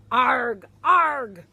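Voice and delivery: loud grouchy pirate growl-word; loud grumbling arg arg; grumpy pirate arg. Acted. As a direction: bark it out like a cranky pirate. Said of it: "He grumbles and he grouches".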